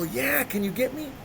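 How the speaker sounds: high, keyed-up acted reply; eager phone request. Asking his friend for a ride, eager. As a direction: ask a buddy eagerly.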